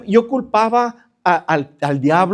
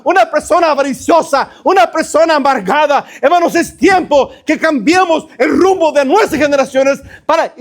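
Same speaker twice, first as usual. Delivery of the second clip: raised, high-pitched sermon delivery; fervent preaching; 6 semitones higher, 4 dB louder than usual. Real, not acted. Pastor urging hermanos to change for their families, impassioned preaching building in intensity. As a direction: exhort the congregation with mounting force, building to a shout.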